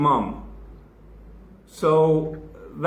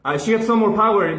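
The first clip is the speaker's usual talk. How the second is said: usual speaking voice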